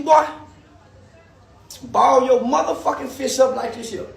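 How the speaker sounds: ordinary delivery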